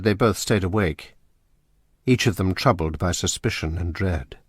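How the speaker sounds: ordinary talk, nothing marked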